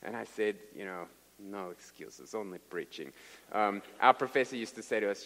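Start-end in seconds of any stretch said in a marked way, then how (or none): 1.4-3.1 low, soft catchphrase; subdued echo. Retold or imitated. Sick preacher repeating his translator's motto, low and quiet. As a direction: recall it quietly, resigned to preaching.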